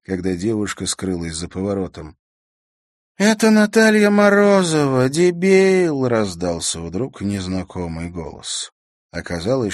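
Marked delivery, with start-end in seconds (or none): 3.2-6.1 high, loud heckle from a bystander; loud, high-pitched put-down; indignant call. A narrator's reading. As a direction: call out the insult in a high, raised voice. Unknown girl cuts in, insulting him.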